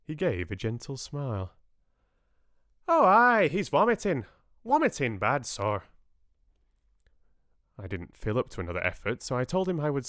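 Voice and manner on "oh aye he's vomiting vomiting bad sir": loud, high Irish brogue, settling down; Irish lilt, starting high and loud; rich Irish accent. A narrator's reading. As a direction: exclaim in a broad Irish accent as it dawns. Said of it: "spoke with a rich, unexpected accent".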